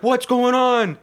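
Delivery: high, loud mock-panicked cry; bewildered outcry. Whole crew reacting to the downed plane, hammed-up bewilderment. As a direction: shout it high in exaggerated confusion. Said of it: "Everybody's like".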